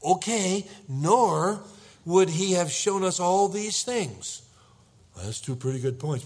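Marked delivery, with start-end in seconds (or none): 0.0-4.4 louder, higher, emphatic retort; exasperated wife. Acted. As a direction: reason firmly with your nervous husband, stretching it out. Acted exasperated wife answering nervous husband.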